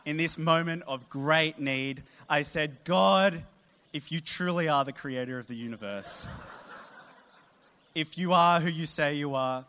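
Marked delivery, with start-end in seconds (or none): 2.8-9.7 loud, pleading prayer, much higher; desperate cry to God. Retold or imitated. Praying out loud on the couch for a cricket win, loud plea. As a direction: cry out to God in great need. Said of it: "I cried out to the Lord".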